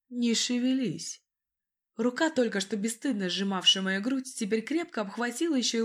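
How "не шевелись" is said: slow firm command; old-time gangster. A narrator's reading. Rescuer orders her.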